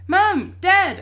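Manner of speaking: high, very loud calls; yelling. A narrator's reading. Boy trapped in cupboard calling parents, yelling. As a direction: holler for your parents. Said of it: "I yell".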